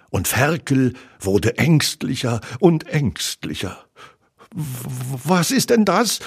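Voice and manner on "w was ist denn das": high, stuttering frightened piglet; timid, trembling question. A narrator's reading. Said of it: "fragte er zitternd".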